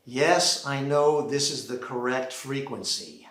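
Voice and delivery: ordinary talk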